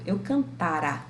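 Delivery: "cantara" with the stress on TA; stress on the middle syllable. Shown as a demonstration. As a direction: slowly lean on TA in "cantara". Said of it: "Veja a minha pronúncia".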